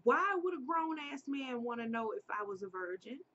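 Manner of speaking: plain reading voice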